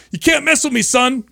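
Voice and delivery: high, smug taunt; cocky comeback. Retold or imitated. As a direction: sass the teacher with a grin. Retelling his cocky comeback to teacher.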